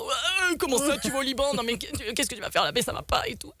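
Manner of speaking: mock alarmed father protesting; imitating her father. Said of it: "Mon père, il était en".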